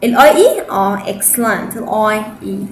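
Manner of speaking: ordinary talk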